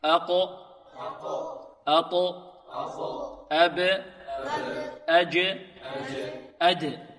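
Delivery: أق أط أب أج أد each with a bouncing final stop; qalqala drill. Shown as a demonstration. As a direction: echo the stop at the end of each syllable. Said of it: "انطقوا معي".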